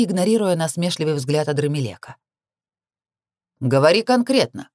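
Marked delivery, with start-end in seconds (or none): 3.6-4.7 firm demand to get to the point; a touch of impatient command. A narrator's reading. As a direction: press for specifics. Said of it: "потребовал Асмодей".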